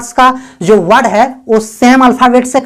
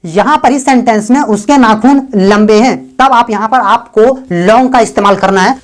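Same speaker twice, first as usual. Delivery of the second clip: ordinary delivery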